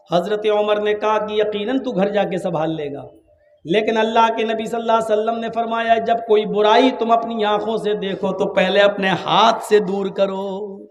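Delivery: normal talking voice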